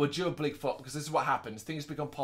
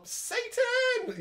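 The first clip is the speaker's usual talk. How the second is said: shrieked high, in a put-on voice; shocked nun. A nun seeing a baby hatch, mock shriek. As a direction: cry out like a horrified nun. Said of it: "you might go".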